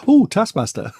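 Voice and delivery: high, excited "ooh" of recognition; mock-starstruck exclamation; delighted surprise. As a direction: squeal it lightly, like spotting a hero. Re-enacting delight at seeing bus.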